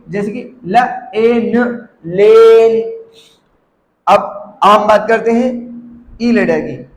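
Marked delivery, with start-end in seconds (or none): 0.7-3.0 ल, ए, न apart, then long लेन; sounded out slowly. Shown as a demonstration. Teacher showing the long ए, sounded out slowly. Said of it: "ए pronounce करेंगे".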